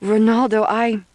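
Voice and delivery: high, loud gasp of name; breathless cry. A narrator's reading. Climax moment in narration.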